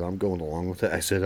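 usual speaking voice

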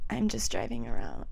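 quiet, offhand phone answer; evasive. Retold or imitated. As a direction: play it down softly, dodging the question. Evasive answer on retold phone call.

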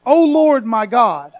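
loud, pleading cry to God. Acted. Preacher voicing David crying out to God, loud plea. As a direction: call out to the Lord with everything. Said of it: "that is crying out to God".

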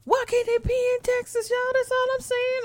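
very high comic whine; high-pitched whining. Acted. Host wanting a Texas lottery winner, high mock whine. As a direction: squeal the complaint like a pleading child.

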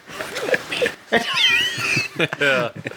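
squealing burst of laughter; high shrieking laugh. Real, not acted. Silly joke reaction.

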